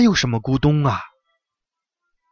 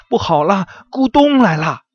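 high, louder gasping rabbit cry; little rabbit gasping in panic; panting, frightened; 9 semitones higher, 4 dB louder than usual. Acted. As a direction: blurt it out of breath, scared. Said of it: "一边喘着气说".

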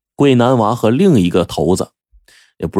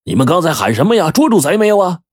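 calling out; 7 semitones higher than usual. A narrator's reading. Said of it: "边跑边喊".

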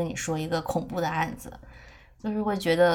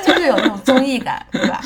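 laughing; 6 semitones higher, 11 dB louder than usual. Real, not acted. Laughing after the impression.

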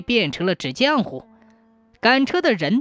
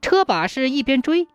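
plain reading voice

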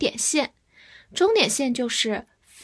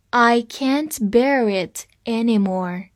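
slow, clear read for students to repeat; slow teacher read-along; read slowly; 3 dB louder, slower than usual. Shown as a demonstration. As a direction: stretch each word out for learners. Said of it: "跟我慢慢读一下".

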